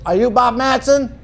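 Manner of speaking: high, quoted publisher asking; publisher's question. Retold or imitated.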